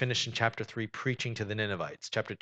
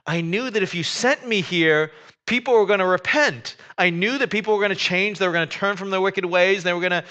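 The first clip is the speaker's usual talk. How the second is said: higher and louder, exasperated complaint to God; indignant Jonah; 7 semitones higher, 6 dB louder than usual. Retold or imitated. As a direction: rant at God like a sulking prophet. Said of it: "he's exceedingly angry".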